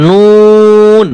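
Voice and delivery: নুন stretched long on a rounded 'oo'; very slow, sustained নুন with pursed lips. Shown as a demonstration. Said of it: "মুখ অবশ্যই গোল করতে হবে".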